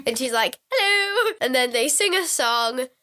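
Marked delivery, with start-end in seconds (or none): 0.7-1.3 high, drawn-out hello; high mock-sweet greeting; acted bright greeting. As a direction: sing out a hello, pitched way up. Juliet greeting the startled boy.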